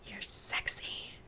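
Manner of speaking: breathy whispered compliment. Acted. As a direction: whisper it close to the mic.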